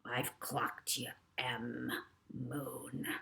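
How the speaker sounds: low, soft whispered threat; villain whispering to himself on the roof; menacing whisper. A narrator's reading. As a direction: breathe the threat darkly.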